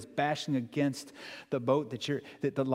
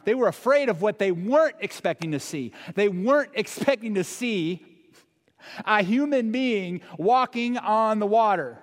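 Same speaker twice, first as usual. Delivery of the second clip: emphatic preaching; 5 semitones higher, 5 dB louder than usual. Acted. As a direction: hammer home the wonder. Emphatic slow building climax.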